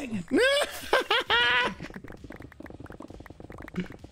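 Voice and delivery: high squealing laugh; cartoon laugh. Real, not acted. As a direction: burst into a high cartoonish cackle. Reacts to someone leaving.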